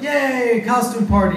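high, excited yay; mock cheering. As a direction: cheer it like thrilled friends. Friends cheering at party news.

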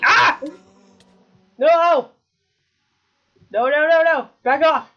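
high, loud yelps; panicked screaming. Real, not acted. Player cornered by the monster, panicked shouting. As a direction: scream to drive the attacker off.

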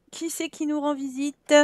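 ordinary talk, nothing marked

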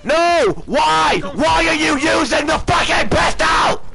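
raging yell at the enemy player; furious shout. Real, not acted. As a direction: shout it out, livid. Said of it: "Absolutely screaming".

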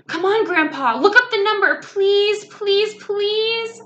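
put-on high, loud childish pleading; whiny-kid plea rising into insistent pleases; begging. A narrator's reading. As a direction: beg like an impatient little girl. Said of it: "I begged".

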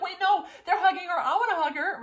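giddy mock protest; excited protest. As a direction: whine it out, laughing and eager. Impatient to hug her queen.

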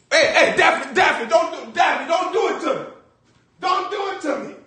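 high frantic shouting, begging him to stop; panicked pleading. Acted. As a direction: yell in terror, voice way up. Acted panic, pleading with shooter.

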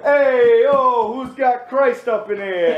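loud, high New York Italian voice; Italian Jesus strutting in loud; New York Italian accent. Pretend. Italian Jesus greeting the room, loud New York Italian accent.

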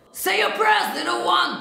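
high, loud gritty metal shout; menacing darkened distortion; dark distorted metal. Shown as a demonstration. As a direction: belt it high with dark grit. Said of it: "this kind of sound".

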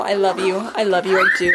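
talking tenderly to the dog; tender cooing. Real, not acted. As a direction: murmur love to the pet.

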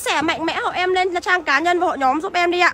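shouting the plea for shares at full volume; loud calling out. Real, not acted. Car seller on livestream urging viewers to share, loud call. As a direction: call it out loud to the whole stream.

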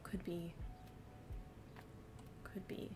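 whisper. Real, not acted. Unsure muttering to herself.

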